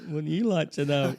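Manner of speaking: smug villain. Retold or imitated. Villain's smug reply retold.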